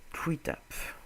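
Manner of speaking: tree taps said with a put-on English accent, tree like English tree, taps with a short English a; mock English pronunciation of tree taps. Streamer putting on an English accent for tree taps. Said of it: "Avec mon accent English".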